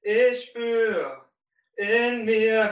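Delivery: ordinary talk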